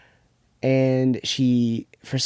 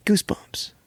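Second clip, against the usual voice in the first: spooky whisper; 8 semitones higher than usual. Pretend. Spooky title read.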